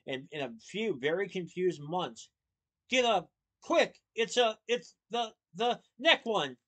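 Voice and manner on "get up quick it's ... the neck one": high, loud panicked stammer; frantic high yelling, last words stretched; agitated. A narrator's reading. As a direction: shout in a panic, fumbling for the word. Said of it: "He was so agitated".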